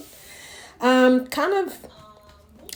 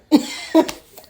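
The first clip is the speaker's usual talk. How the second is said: airy burst of laughter; laughing; 9 semitones higher, 7 dB louder than usual. Real, not acted. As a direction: laugh at yourself breathily. Laughs at small win.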